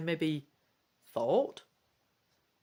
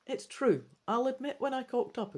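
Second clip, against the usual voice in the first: ordinary talk, nothing marked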